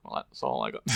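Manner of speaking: deep, half-hearted film quote impression; Forrest Gump. Quoting a film when someone stops mid-sentence, low put-on voice. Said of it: "I can't at all do his accent".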